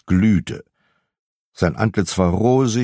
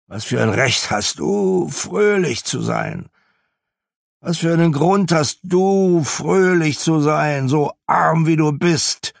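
put-on grumpy old miser, rising indignation; 8 semitones higher than usual. A narrator's reading. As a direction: throw his cheer back at him. Scrooge's grumpy retort.

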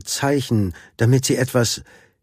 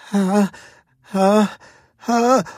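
high, separated sneeze gasps; three rising "ha"s before a sneeze; sneeze build-up; 10 semitones higher, slower than usual. A narrator's reading. Baby elephant with feathers in trunk, about to sneeze. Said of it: "Die Wucht seines Niesers".

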